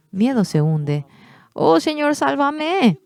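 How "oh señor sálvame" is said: high, loud desperate cry; cry for help. Acted. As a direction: scream to be saved as you sink. Pedro sinking cries for help. (grito desesperado y agudo)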